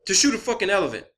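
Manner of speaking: ordinary talk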